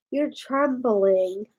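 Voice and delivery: slow, soft and low; slow hushed sympathy; tender concern. Real, not acted. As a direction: gently comfort a shaking dog. Noticing the scared dog.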